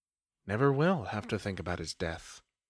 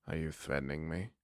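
much lower and softer, guarded; quiet, wary challenge; 8 semitones lower, 11 dB quieter, faster than usual. Acted. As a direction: ask it low and steady. Paige confronts the vampire.

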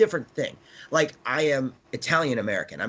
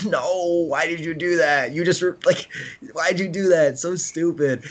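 higher-pitched, dismayed fan outburst; exasperated protest; 5 semitones higher than usual. Acted. Fan reacting to Boba's line on the show, exasperated. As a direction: plead with the writers in mock despair. Reacting in frustration to a show's dialogue.

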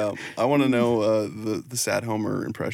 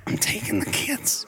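raspy, unsympathetic cartoon wife impression; Marge Simpson; 11 semitones higher, 4 dB quieter than usual. Wife leaving sad husband, mock unsympathetic. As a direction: rasp it like a fed-up cartoon wife. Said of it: "Marge having no sympathy for him".

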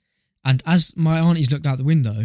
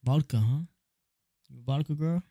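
American man; 10 dB quieter than usual. Pretend. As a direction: play an American at a bar, quietly. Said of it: "You are a American man sitting there".

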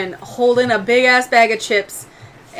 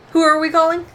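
higher-pitched character question; Isis; 8 semitones higher, 4 dB louder, faster than usual. Acted. Game master's character walking up to ask, high-voiced query. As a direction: lift the voice for an inquiring character.

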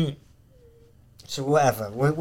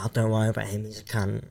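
hushed aside; 4 semitones lower, 11 dB quieter, faster than usual. Retold or imitated. Friend whispering aside.

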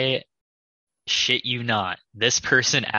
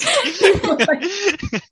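high laugh; 6 dB louder than usual. Real, not acted. Laughing after the barista story.

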